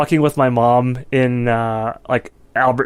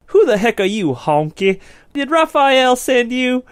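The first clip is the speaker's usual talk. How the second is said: hammed-up threatening youth; mocking a street gang kid confronting a stranger; tough street kid; 8 semitones higher than usual.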